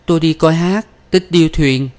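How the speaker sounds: high-pitched, put-on innocent answer; lightly voiced young woman; awkward daughter. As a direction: offer an excuse with a forced smile. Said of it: "Đào mỉm cười gượng gạo".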